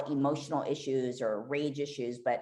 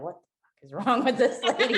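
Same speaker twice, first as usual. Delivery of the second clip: higher, louder mimicked disbelief, breaking into laughter; baffled other driver; 8 semitones higher, 6 dB louder than usual. Driver who hit her reacting to her rant, mock bafflement. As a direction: imitate a bewildered bystander, amused. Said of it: "the man that hit me was probably like".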